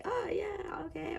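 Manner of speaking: high, soft, unsure little voice; timid high reply. Retold or imitated. Voicing the shy card figure, high and soft. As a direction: squeak out a shy yes.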